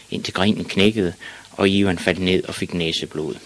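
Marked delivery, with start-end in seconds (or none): none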